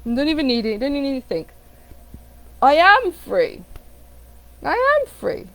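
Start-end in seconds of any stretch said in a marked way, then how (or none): 2.6-5.5 higher, stretched, bold declaration; emphatic affirmation. Shown as a demonstration. Coach demonstrating a proper affirmation, emphatic and certain. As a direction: declare it with full conviction. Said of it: "You're not writing an affirmation like".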